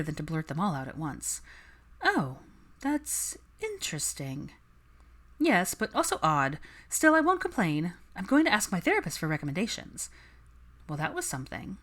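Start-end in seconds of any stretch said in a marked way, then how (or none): 2.0-4.5 measured interest. Acted. Hermione careful reply. 5.4-10.1 high, bright chatty voice; breezy and upbeat; lively friend. Acted. Daphne voiced.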